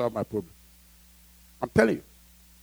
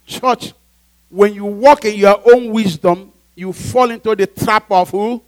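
loud, raised-pitch sermon; full-force preaching; 6 semitones higher, 7 dB louder, slower than usual. Acted. Preacher warning the church of Satan's trap, forceful preaching. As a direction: drive it home to the congregation.

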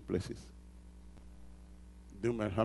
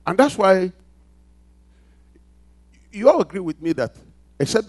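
loud, high, driving pulpit voice; preaching at full force; 6 semitones higher, 13 dB louder than usual. Acted. Pastor rousing the congregation, preaching at full force. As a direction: build it up and hammer each phrase.

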